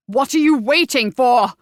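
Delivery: loud, urgent yell. Acted. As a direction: snap at him to move. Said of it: "he yelled at Willoughby".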